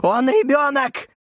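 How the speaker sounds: slow, high anguished cry; yelling. A narrator's reading. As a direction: stretch it into a high, pleading cry. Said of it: "заорала она".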